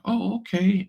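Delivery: high, pleasantly surprised "oh, okay"; impressed reaction. Retold or imitated. Listeners hearing her pitch, high and impressed. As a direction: lift it like you're won over. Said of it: "people are like".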